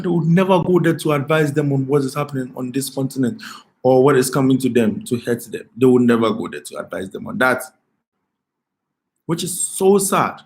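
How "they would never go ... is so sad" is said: ordinary talk, nothing marked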